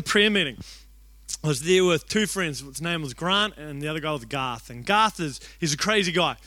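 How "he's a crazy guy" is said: ordinary delivery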